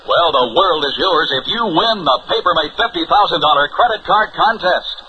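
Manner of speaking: normal talking voice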